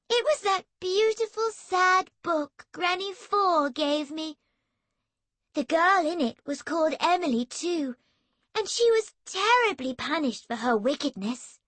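high, saintly sister's voice; pious and sweet. Acted. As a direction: play the holy little saint for her brother. Emily pious, sugary tone.

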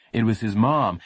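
ordinary delivery

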